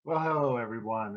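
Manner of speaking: low, soft sultry whisper; sexy voice. Acted. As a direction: purr it out low and seductive. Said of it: "I'm gonna be talking like this in my sexy voice".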